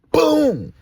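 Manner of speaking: explosive high shouted boom; shouted sound effect; imitated bang. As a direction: burst out loud like a sudden blast.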